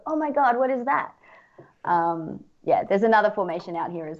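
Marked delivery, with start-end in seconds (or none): 0.1-1.1 amazed tourist. Retold or imitated. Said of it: "they get off the plane and they go".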